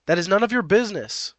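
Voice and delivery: curt rebuff. Acted. Narrator voices Happy refusing OP's question, curt. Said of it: "She snaps on her heel".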